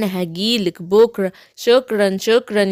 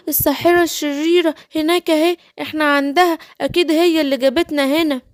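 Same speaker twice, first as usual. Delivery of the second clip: small Smurf, higher and puzzled; a little creature voice, higher and bewildered; 8 semitones higher, faster than usual. Pretend. Trapped Smurfs wondering in jar. (صوت سنفور صغير أعلى ومتحير)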